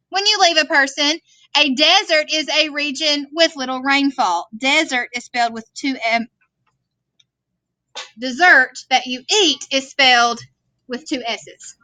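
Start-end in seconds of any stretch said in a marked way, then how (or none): none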